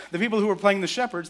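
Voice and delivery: normal talking voice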